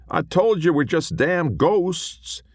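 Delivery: high moaning cowboy ghost. A narrator's reading. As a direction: moan it up high and slow. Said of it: "moaned Billy".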